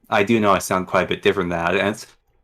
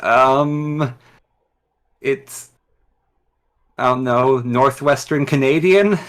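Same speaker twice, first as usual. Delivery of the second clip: drawn-out, higher, unsure reply; hesitant answer; 5 semitones higher, slower than usual. Retold or imitated. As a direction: answer haltingly, ending like a question. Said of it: "And it's like".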